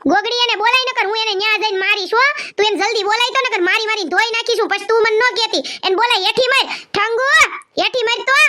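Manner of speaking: high-pitched angry yelling, threatening; furious shouting. Acted. An enraged elder ordering Thangu brought downstairs, shouting. Elder raging about runaway girl.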